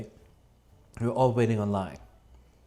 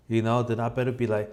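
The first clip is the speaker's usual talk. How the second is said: normal talking voice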